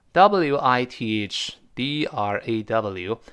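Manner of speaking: each letter of "W I T H D R A W" said slow and separate; slow spelling. Shown as a demonstration. Teacher spells the word for students, very slowly. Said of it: "spelling the word".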